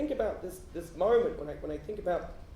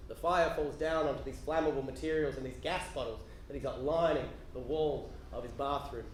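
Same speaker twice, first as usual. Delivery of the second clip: ordinary talk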